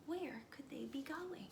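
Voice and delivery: whispered question, falling at the end; suspenseful whisper. A narrator's reading. Narrator wondering where the ants go, whispering.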